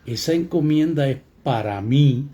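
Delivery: "mí" loud and fully stressed at the end; clear, strong accent on "mí"; stressed pronoun MÍ. Shown as a demonstration. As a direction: land firmly on the final "mí". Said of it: "oigan lo distinto que suena".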